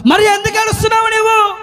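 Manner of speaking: high, ringing call; Jesus. Retold or imitated. Jesus asking Mary why she weeps, high ringing call.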